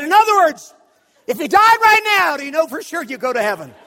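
very loud, high shouted demand; hammed-up interrogation at full volume; aggressive challenge. Preacher corners stranger about heaven, aggressive shouted question. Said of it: "I pointed it like it was an Uzi".

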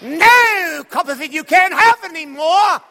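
very loud, high mock-British bellow; hammed-up Dickensian master refusing an orphan; Dickens villain. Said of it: "I felt like I was in the Charles Dickens play".

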